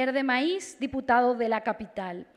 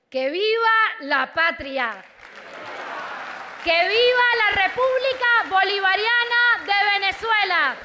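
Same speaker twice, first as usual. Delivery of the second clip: rousing patriotic shouts, building; booming balcony speech; 4 semitones higher, 5 dB louder than usual. Real, not acted. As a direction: cry out to the crowd, rising. Closing vivas after reading the act.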